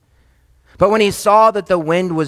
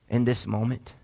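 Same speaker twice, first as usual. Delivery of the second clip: normal talking voice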